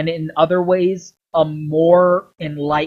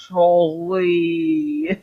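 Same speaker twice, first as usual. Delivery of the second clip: grim greeting; much slower than usual. Retold or imitated. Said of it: "And Connor's like".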